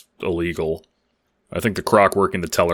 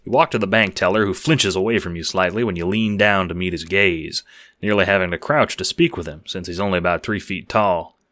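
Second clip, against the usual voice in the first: plain reading voice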